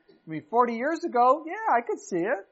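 high, loud mock boast; young bragging Moses. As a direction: puff it up like a cocky young man.

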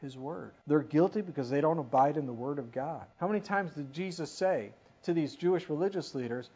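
ordinary talk

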